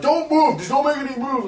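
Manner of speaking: mock cop order. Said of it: "And they're like".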